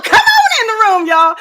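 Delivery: high, loud welcome shout; excited shout. Real, not acted. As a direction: call out to the room.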